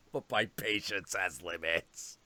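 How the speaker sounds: low, dark warning; ominous cat. Retold or imitated. Cat warning its would-be friend, low and dark. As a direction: let the threat sink in. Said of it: "he's like".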